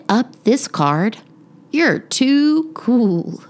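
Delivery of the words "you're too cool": playful. Acted. Girl reads card aloud.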